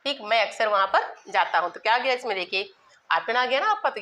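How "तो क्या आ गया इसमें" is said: ordinary talk, nothing marked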